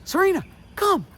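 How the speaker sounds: high-pitched happy recall call. Shown as a demonstration. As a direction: call her name way up high and cheerful. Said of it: "I want you to do it like this".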